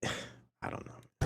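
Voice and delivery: breathy exasperated sigh, whispered and slow; giving up on the clip with a sigh. Real, not acted. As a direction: let it all out in a tired sigh.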